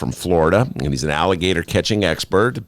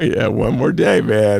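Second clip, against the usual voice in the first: Alligator Bob voice; slower than usual. Retold or imitated. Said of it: "Alligator Bob impression".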